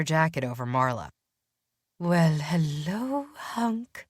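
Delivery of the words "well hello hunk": coy, teasing purr to the vampire; purring coat rack. A narrator's reading. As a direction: draw the greeting out like a flirty purr. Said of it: "the coat rack purred to the vampire".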